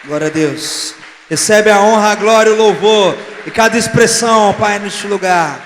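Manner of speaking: high, very loud shouted prayer; impassioned preacher shouting in prayer; full-force praise. Real, not acted. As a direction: cry out praise to God over the applause. Shouted praise prayer over applause.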